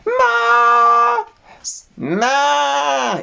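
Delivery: high, loud bleating cry; old ewe calling. Acted. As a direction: echo the call like a startled ewe.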